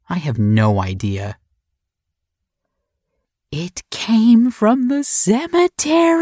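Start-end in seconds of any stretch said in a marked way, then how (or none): 3.5-6.2 put-on little girl voice. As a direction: blurt it out like a spooked little girl. Little sister, excited and scared.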